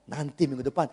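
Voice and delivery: put-on low, soft dad putting the kid off; lightly voiced father stalling his child; placating father. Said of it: "Papinya ngomong".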